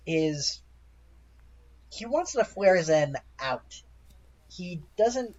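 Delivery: ordinary delivery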